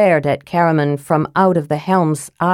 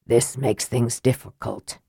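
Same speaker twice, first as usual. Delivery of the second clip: lower, softer grim voice; lightly voiced Raistlin; 6 semitones lower, 7 dB quieter than usual. Raistlin to the group, low and soft. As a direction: murmur it grimly.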